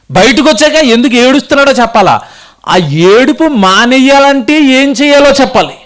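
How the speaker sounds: high, ringing preacher's challenge; forceful preaching questions. Acted. Preacher challenges congregation about the baby's crying, forceful preaching.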